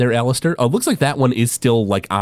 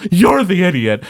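higher-pitched indignant comeback; mock-squabbling retort; arguing; 7 semitones higher, 4 dB louder than usual. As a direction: throw the insult straight back. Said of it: "they both start arguing".

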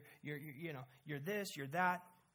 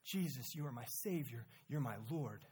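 hushed confession; 7 semitones lower, 7 dB quieter than usual. Retold or imitated. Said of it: "I'm so guilty of that".

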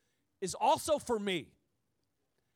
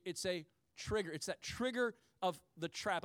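usual speaking voice

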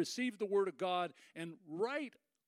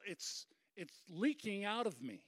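ordinary talk

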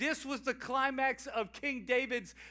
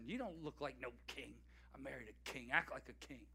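much softer, biting reproach; hushed reproach; 10 semitones lower, 15 dB quieter, faster than usual. Retold or imitated. Michal reproaching David, hushed and bitter. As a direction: hiss it to him like a bitter wife.

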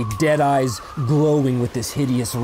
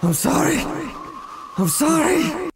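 anguished apology; 11 semitones higher than usual. Acted. Nightmare, repeated anguished apology.

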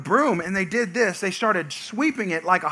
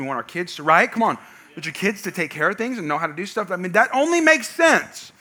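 ordinary talk, nothing marked

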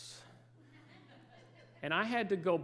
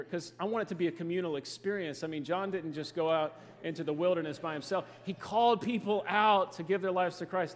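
ordinary talk, nothing marked

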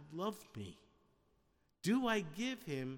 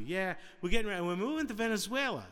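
higher, quick burst of news; excited announcement; 5 semitones higher, 5 dB louder, faster than usual. Retold or imitated. Bride telling family about moving to Venezuela, excited. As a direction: blurt it out eagerly, pitched up.

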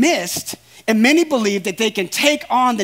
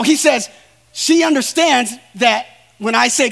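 ordinary talk, nothing marked

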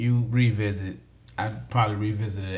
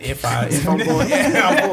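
laughing while admitting fullness; 6 semitones higher, 5 dB louder than usual. Real, not acted. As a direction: laugh through the words. Laughing about being full.

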